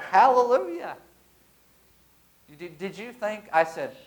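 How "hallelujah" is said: high, loud hallelujah of relief; joyful exclaim. Retold or imitated. New father at the birth, loud joyful praise. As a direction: cry out praise in joy. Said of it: "And I was like".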